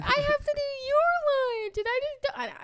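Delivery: drawn-out, very high mock sobbing wail; retold crying. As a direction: stretch it into an exaggerated tearful whine. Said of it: "I'm crying".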